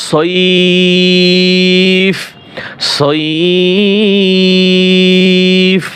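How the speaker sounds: সইফ with the ই glide held very long; long stretched vowel. Shown as a demonstration. Teacher showing the stretch in সইফ. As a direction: pull সইফ out slowly. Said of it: "এই যে টান দিতেছি".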